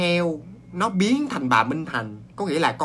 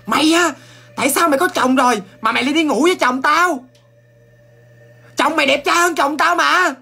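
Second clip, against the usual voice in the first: much higher, much louder shrill accusation; furious yelling wife; 12 semitones higher, 8 dB louder than usual. Acted. Another man's wife screaming at Minh Thành, furious and much louder. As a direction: scream at her for stealing your husband. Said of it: "bà vợ của cái ông kia chửi là".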